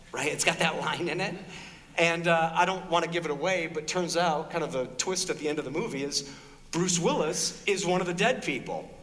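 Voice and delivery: ordinary delivery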